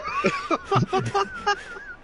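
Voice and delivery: long squealing laugh; high laugh. Real, not acted.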